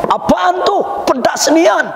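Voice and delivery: high mock gripe; complaining husband. Husband grumbling at wife's cooking, high mock gripe. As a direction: act out a husband grumbling at spicy food.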